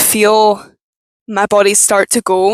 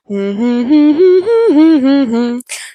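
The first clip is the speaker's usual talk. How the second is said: forced laugh; 5 semitones higher, 3 dB louder than usual. Shown as a demonstration. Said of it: "I have to go".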